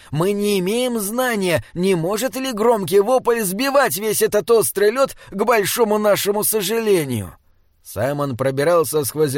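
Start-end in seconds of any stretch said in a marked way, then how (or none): 0.1-7.3 high-pitched troll character voice; fussy troll, warning. A narrator's reading. Troll warning Simon about falling ice, high voice. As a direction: play the troll high and precise. Narrator plays troll Binabik.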